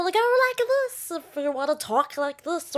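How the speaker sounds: high, goofy put-on voice; silly voice. Shown as a demonstration. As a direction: pitch it way up, playfully silly. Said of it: "make a silly voice".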